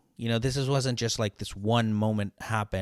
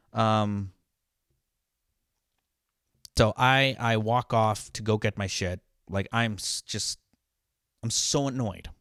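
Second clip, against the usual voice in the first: normal talking voice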